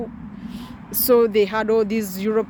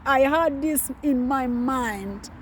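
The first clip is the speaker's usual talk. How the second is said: usual speaking voice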